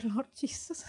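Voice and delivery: very soft little prayer; hushed prayer. Retold or imitated. Retelling her prayer beside a tough grandma, hushed. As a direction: pray it under your breath. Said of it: "And I just pray".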